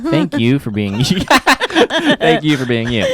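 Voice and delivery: words breaking into laughter; laughing. Real, not acted. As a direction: laugh through the thank you. Sincere thanks dissolving into laughter.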